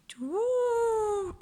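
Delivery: high tooting bird voice; hooting owl. Acted. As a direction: hoot it out like an owl in a treetop. The owl answers.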